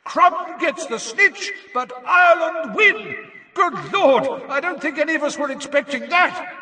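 high, loud, amazed commentary; astonished announcer's shout. A narrator's reading. Commentator taken aback by sudden match end, shouting. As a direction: proclaim the result, then marvel at it. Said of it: "shouted Bagman, who ... seemed to have been taken aback".

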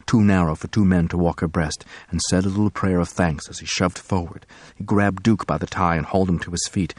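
ordinary delivery, nothing marked

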